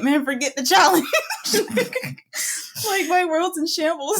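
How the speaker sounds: laughing, mock-dramatic despair; high, laughing through it. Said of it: "I was just like".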